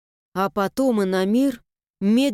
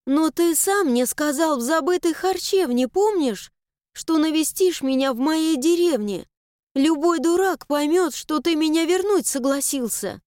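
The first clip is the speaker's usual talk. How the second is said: put-on high, reproachful girl's voice; pleading girl; 6 semitones higher than usual. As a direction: plead with him, wounded. Mila pleads, indignant.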